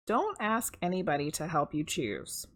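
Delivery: ordinary delivery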